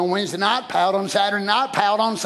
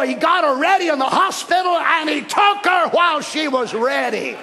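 raised, emphatic pulpit storytelling; full-force preaching; 5 semitones higher, 3 dB louder than usual. Real, not acted. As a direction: proclaim it to the whole church.

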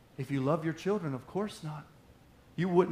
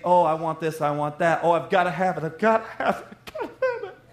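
put-on a kid pleading in the toy aisle, rising pitch; whiny kid; 2 semitones higher, 7 dB louder than usual. Child begging in toy aisle.

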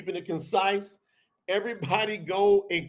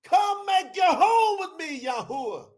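high, slow, loud pleading scream; 11 semitones higher, 5 dB louder, slower than usual. Retold or imitated. Preacher reenacting calling God into his home, pleading scream. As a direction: cry out to God, drawn out. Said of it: "I screamed out".

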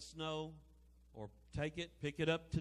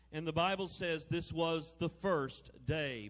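usual speaking voice

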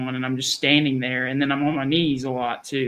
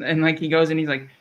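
ordinary talk, nothing marked

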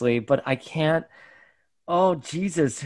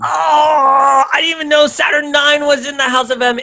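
surprised shout; 10 semitones higher, 5 dB louder than usual. Real, not acted. Surprised outburst.

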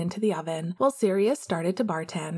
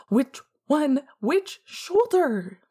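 high, slow panicked demand; frightened demand; 7 semitones higher, 3 dB louder, much slower than usual. A narrator's reading. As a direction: demand it in fear. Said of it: "James demanded".

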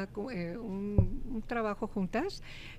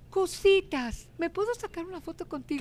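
high, eager ask for a photo; a star sheepishly asking a fan; shy excited request; 8 semitones higher than usual. Retold or imitated. Actress asking the host for a photo, pitched high and eager. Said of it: "ella me dijo".

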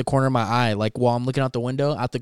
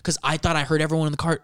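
ordinary talk

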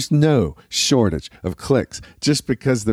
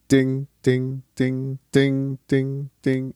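higher, fading ding count-off; 5 semitones higher, much slower than usual. Shown as a demonstration. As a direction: sing the count-off dings. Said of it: "a count off at the beginning".

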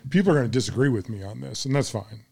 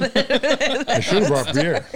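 laughing; 9 semitones higher, much slower than usual. Real, not acted. Said through laughter.

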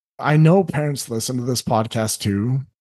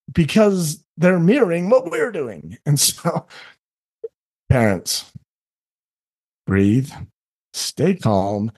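ordinary talk, nothing marked

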